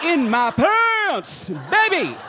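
drawn-out, high comic brag; sassy squeal; high-pitched sassy boast. Pretend. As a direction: stretch it up high like a hammed-up diva. Comic brag about being small.

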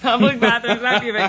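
correcting herself through laughter; laughing. Real, not acted. Laughing at her own slip.

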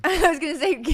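laughing through the words; cracking up as she starts to speak. Real, not acted. Wife laughing as she cuts her husband off.

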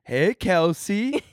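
put-on slow, high babyish voice. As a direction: talk like a baby. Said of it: "we were doing baby voices".

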